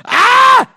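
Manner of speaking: very high, loud shriek of fright; sudden real scream at a bug; piercing yelp of alarm; startled scream. Real, not acted. Told of bug on leg.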